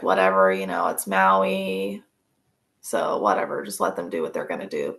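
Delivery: careless grandma. Retold or imitated. Narrator voicing her grandma shrugging off the kids' drinking in Maui, low and dismissive. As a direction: drop the pitch way down and brush it off like a lazy grandma.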